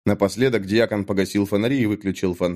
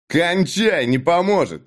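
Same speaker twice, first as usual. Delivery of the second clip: a gruff brush-off, higher; Dag, dismissive; 7 semitones higher than usual. A narrator's reading. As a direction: cut him off rudely. (Даг грубо обрывает Натана)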